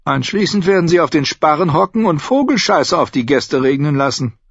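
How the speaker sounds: angedeuteter Tyrion, trocken spottend; spöttisch. Acted. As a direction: verdirbt ihr genüsslich die Vorfreude. (Tyrion trocken spöttisch)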